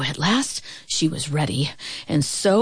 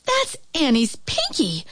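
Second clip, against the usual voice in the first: high-pitched exclamation, no real shout; excited cry; 6 semitones higher than usual. A narrator's reading. Mother spots her daughter's ball, high excited cry. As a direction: cry it out high, at normal volume. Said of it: "cried her mother".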